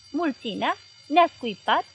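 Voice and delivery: a predator just provoked, snapping awake; startled disbelief. Acted. Hitler hearing of the insult, startled. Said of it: "tresări Hitler ca un animal de pradă".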